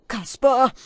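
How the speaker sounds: hoch und laut gerufen; lauter Ruf. A narrator's reading. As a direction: ruf ihn scharf an. Falke ruft Kaspar an. (laut rufend)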